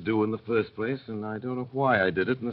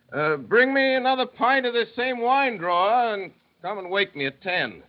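put-on drunken call; 11 semitones higher, 6 dB louder than usual. Drunk Carton calling the drawer.

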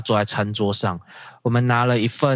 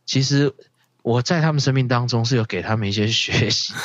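ordinary talk

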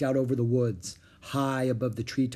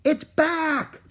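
high, loud excited shout; thrilled high-pitched holler; excited calling; 5 dB louder than usual. A narrator's reading. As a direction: call big news from far off. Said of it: "Annie called from the woods".

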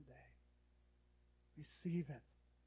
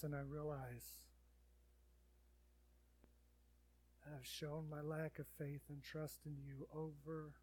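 praying; 4 dB louder than usual. Acted. Leads a prayer.

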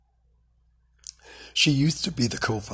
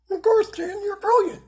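dog's voice; 5 dB louder than usual. Pretend. Dog voice answers Dan.

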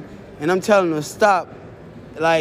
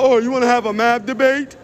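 mocking voice; 5 semitones higher than usual. Mocking the boys online.

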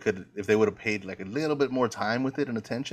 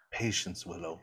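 hushed, softer retelling of a whisper; imitated whisper; 5 semitones lower, 9 dB quieter than usual. Retelling the sorceress whispering to Willow, imitated whisper. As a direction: drop low and soft, as if whispering. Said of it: "that's when she whispers".

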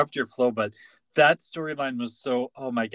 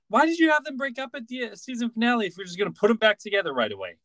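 exasperated rant; 7 semitones higher, faster than usual. Real, not acted. Ranting at writers.

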